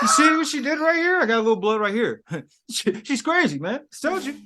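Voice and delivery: high, loud put-on voice of a sleazy boss; mock villain boss. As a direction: ham up the smug boss showing off his wound. Acting out the boss after the stabbing.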